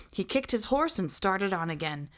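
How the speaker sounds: usual speaking voice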